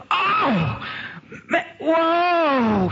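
high, stretched-out whoa; hammed-up astonishment; acted overwhelmed cry. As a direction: exclaim as if knocked reeling. Acts out woman overwhelmed by power.

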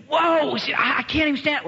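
high, stammering astonishment; acted overwhelmed cry. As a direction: reel and stumble over the words. Acts out woman unable to stand.

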